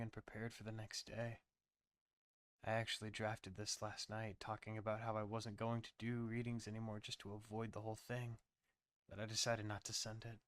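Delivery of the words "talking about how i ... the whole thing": plain reading voice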